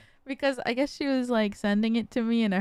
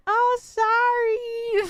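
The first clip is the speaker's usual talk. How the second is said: high, drawn-out singsong imitation of mom; mock a careless apology, stretched out; breezy mom apology; 11 semitones higher, 3 dB louder, much slower than usual. Mom brushing off the worried daughter, breezy apology.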